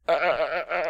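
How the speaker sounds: stuttering as if put on the spot; stage-fright stammer. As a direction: freeze up on repeated false starts. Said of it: "Stage fright".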